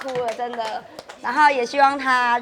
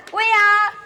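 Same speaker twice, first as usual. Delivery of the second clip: drawn-out cheer; 10 semitones higher, much slower than usual. Real, not acted. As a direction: belt out the last word, slow. Bridesmaid cheers the punchline.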